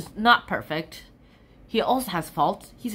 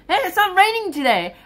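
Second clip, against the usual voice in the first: mock cheerful friend; 9 semitones higher, 6 dB louder than usual. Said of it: "his friend told him".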